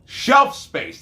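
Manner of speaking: high, loud "Shelf space" correction; sudden loud correction of his own slip; shouted correction. Real, not acted. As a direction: bark out the right word. Correcting himself loudly.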